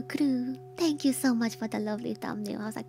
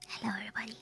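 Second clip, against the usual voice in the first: whispering; 8 semitones lower, 7 dB quieter than usual. Real, not acted. Whispered Halloween stream intro.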